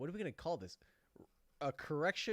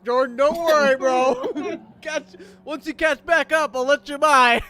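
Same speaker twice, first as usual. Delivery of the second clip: loud, high, laughing taunt; mock magnanimous teasing; 10 semitones higher, 15 dB louder than usual. Racer loudly teasing Jordan, who crashed, high and smug. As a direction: shout it over the mic like a smug winner.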